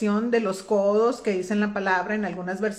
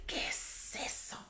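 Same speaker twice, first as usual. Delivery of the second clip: whispered question; 18 dB quieter than usual. Retold or imitated. David retold asking, whispered.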